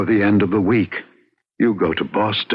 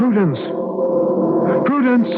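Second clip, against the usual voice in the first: calling out; 13 semitones higher than usual. Acted. David calling after Prudence as she vanishes, high desperate call. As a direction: cry her name after her as she goes.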